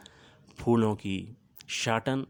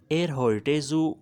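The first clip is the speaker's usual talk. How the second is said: ایر ہوئٹے زو called out higher and louder; loud German demo; 5 semitones higher, 5 dB louder than usual. Shown as a demonstration. Teacher calling out the German sentence for learners. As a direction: project ایر ہوئٹے زو clearly, rising on ایر. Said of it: "زو ہوئرن، سننا".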